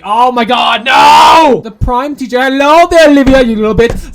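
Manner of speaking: loud outraged outburst at the teacher; yelling at the screen. Real, not acted. Gamer shouts at the prim teacher on screen, loud outrage. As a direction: shout it at the game character, half laughing.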